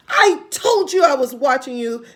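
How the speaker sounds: put-on loud, gloating old hag; cackling. Mrs Twit gloating at her husband, loud and cackling. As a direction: crow over him like a witch. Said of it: "cackled Missus Twit".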